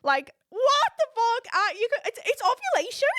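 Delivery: high, loud disbelief; stretched, high-pitched exclamation; exasperated outburst. Real, not acted. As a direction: burst out incredulous, pitch way up. Exasperated outburst about her own trip.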